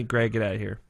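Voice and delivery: ordinary talk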